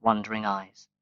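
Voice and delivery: ordinary delivery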